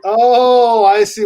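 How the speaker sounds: loud, high drawn-out "oh" of getting it; dawning realization. Real, not acted. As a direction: let the penny drop, loudly. Suddenly gets the friend's joke.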